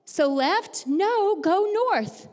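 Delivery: confused question, then a high, drawn-out correction; lightly hammed-up impatience over directions; mock exasperation. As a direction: act out an exasperated back-and-forth. Acted cartoon quarrel over directions.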